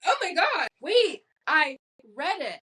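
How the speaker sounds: high, slow astonished gasp of discovery; high, drawn-out mock amazement; sudden realization. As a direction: act out the dumb lightbulb moment. Excited realization, retold own thought.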